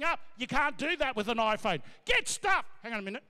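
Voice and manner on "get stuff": high, sharp angry snap. Acted. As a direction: snap it before hanging up. Same angry line repeated.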